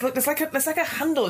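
high Sarah voice. Acted. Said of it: "She says".

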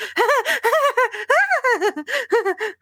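high, giddy nervous laugh; stress laughter. Shown as a demonstration. Player demonstrates near-miss stress laughter, high giddy laugh. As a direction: laugh like you just dodged a car crash. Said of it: "that stress laughter you get".

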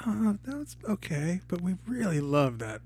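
high, soft mock-polite letdown; lukewarm fans. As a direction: imitate underwhelmed fans letting someone down gently. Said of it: "people are like".

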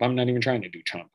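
ordinary talk

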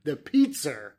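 "pizza" said as "pizzer"; Boston intrusive R. Shown as a demonstration. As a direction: put an R on the end of "pizza". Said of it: "that dropped R comes back onto it".

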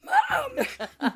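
put-on high, loud kid call; toddler yell. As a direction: shout it like a bossy toddler. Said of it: "And he's like".

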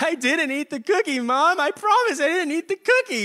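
put-on high, pleading little kid voice; whiny crying kid. Kid denies eating the cookie to mom, high whiny plea. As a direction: whine it like a caught child near tears. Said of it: "he's like".

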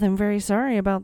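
usual speaking voice

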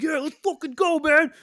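pumped, yelling war cry; hyped-up shout. Pretend. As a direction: hype yourself up, screaming. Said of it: "I'm not trying to make it sound like".

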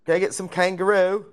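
a hint of a fake Australian; Australian accent. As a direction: tourist ordering kangaroo in a mock Australian accent. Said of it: "I can't do the Australians".

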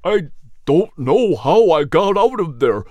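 lightly voiced dazed hound dog, shaken; a hint of a weary dog recounting danger; Nelson's voice. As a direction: recount the fire, still confused. Nelson tells his story.

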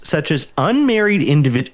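usual speaking voice

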